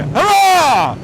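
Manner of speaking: high, loud hammed-up hurrah; mock cheer. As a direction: cheer it like a cartoon hero. Mock cheer arriving in Northamptonshire.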